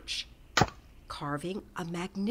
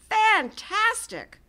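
high, loud, drawn-out fantastic; exclaiming; 14 semitones higher, 8 dB louder, slower than usual. A narrator's reading. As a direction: burst out in wonder. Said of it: "Hoppy exclaimed".

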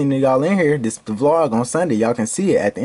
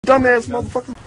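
high, raised-voice rehearsed insult; louder acted insult; 10 semitones higher than usual. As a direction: call it out high for another take. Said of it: "All right, say it louder".

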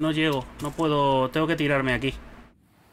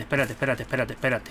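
normal talking voice

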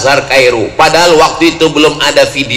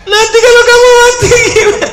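high-pitched, hammed-up tearful mother; fast, high mock sobbing voice; mock crying; faster than usual. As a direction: imitate a mother wailing at a farewell. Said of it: "maknya waktu melepas nangis".

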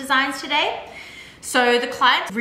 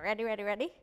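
normal talking voice

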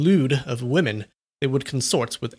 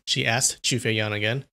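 ordinary delivery

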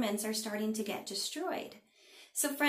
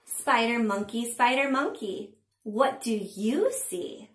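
slow, sing-song picture-book reading; punchy, drawn-out storytime narration; read-aloud to children; 5 dB louder, slower than usual. A narrator's reading. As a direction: read it to little ones with big pitch swings and pauses.

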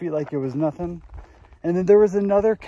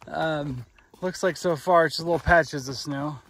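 usual speaking voice

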